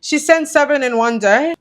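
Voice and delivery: retold disbelief. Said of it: "And I was thinking".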